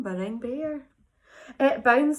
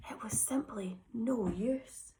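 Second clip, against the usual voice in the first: hushed narration; whisper; 3 semitones lower, 9 dB quieter than usual. A narrator's reading. Narrator sadly tells the children all hope is gone, whispering. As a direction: let the hope drain away in a whisper.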